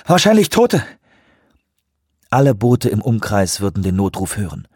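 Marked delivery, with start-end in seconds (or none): 0.1-0.8 high, breathless and grim. Acted. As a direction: gasp the news into the radio. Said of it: "sagte er atemlos".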